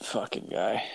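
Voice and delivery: a hushed aside, barely voiced; under-breath whisper. Real, not acted. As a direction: whisper it to yourself, disgusted. Muttered aside about the driver.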